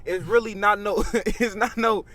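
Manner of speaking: ordinary talk, nothing marked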